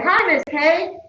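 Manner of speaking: a hint of a friendly student saying hello; student's greeting. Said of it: "what you're gonna do is say".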